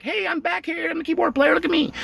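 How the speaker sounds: high, whiny plea for attention; mock keyboard player. A sidelined keyboard player begging in a high whiny voice. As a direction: squeak like a sidelined bandmate. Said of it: "And they're like".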